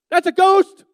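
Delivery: high, loud frightened shout; panicked shout. Retold or imitated. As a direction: yell it out in sudden terror. Peter panicking at figure on water.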